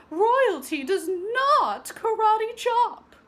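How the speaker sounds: slow, high, loud prim rebuke; stern teacher. Acted. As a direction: stress on "not", very proper. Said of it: "called her teacher".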